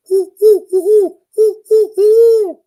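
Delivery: high, loud hooting owl call; owl hoot imitation. Camper calling back to an owl across the flat, loud high hoots. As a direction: hoot it out like a big owl in the night.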